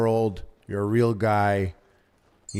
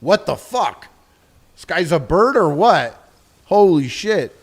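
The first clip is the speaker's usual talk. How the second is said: louder, higher stunned yell; shocked townsperson; 6 semitones higher, 7 dB louder, slower than usual. Pretend. Streamer voices imagined villagers shouting in loud, high shock. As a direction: react like townsfolk seeing a man fly in. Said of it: "no one's like".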